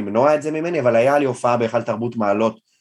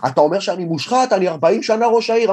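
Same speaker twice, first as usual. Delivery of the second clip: raised, higher indignant tirade; mayor ranting; 6 semitones higher than usual. Retold or imitated. Mayor berating the comedian over the phone, angry. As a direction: hammer him with the mayor's outrage. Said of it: "מתחיל לצעוק עליי בטירוף".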